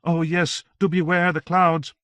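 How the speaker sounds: put-on a devout young warrior calling out; Joan. A narrator's reading. Joan's line.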